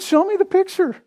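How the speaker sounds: high-pitched, amused retold demand; playful, high-voiced imitation of himself. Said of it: "I'm laughing because I can't remember".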